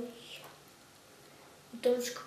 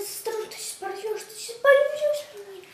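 urgent warning; 7 semitones higher than usual. Real, not acted. Warning child about tearing sticker.

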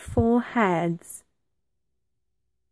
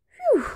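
high-pitched puff of relief; relieved phew; 3 dB louder than usual. A narrator's reading. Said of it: "said the dog".

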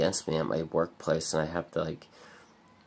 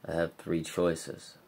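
ordinary talk, nothing marked

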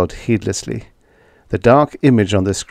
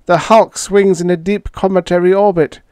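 higher alien voice, incredulous; alien Schla; 10 semitones higher, 4 dB louder, faster than usual. A narrator's reading. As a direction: play the alien in disbelief.

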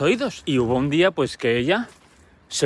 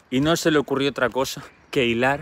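ordinary talk, nothing marked

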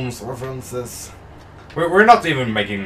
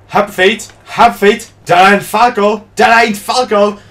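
imitating hyped commentators, high and loud; mock frenzied play-by-play shouting; commentators going nuts; 10 semitones higher, 10 dB louder than usual. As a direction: yell it like a caster losing it. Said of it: "like, going nuts over".

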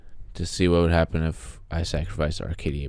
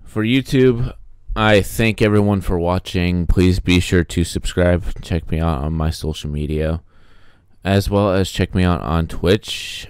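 outro voice; 7 dB louder than usual. Acted. Channel outro sign-off.

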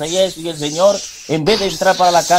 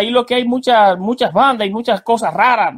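urgent warning; 7 semitones higher than usual. Acted. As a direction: call him into the car, urgent. Hombre del vehículo advierte.

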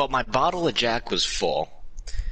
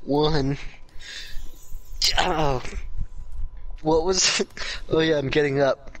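usual speaking voice

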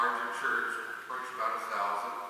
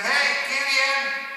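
long, high, loud call across the crowd; 11 semitones higher, 9 dB louder, much slower than usual. Acted. Upset Ephraimites calling out to Gideon mid-battle, loud and drawn out. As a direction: hail him from a distance, drawn out.

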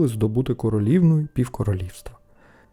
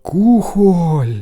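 high, loud, stretched word; proud boyish exclamation; excited announcement; 6 semitones higher, 7 dB louder than usual. A narrator's reading. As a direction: show off your treasure. Boy proudly announces his find.

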